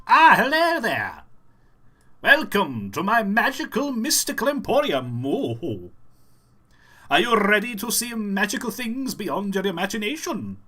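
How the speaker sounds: high, loud, theatrical emporium host; showman character voice. Shown as a demonstration. Shopkeeper welcoming visitors to a magical mystical emporium, over-the-top high voice. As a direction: hail visitors in a madcap high-pitched voice. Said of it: "I just like doing voices".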